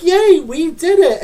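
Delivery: high, loud mock celebration; sarcastic cheer. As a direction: squeal it like fake victory. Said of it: "everyone's like".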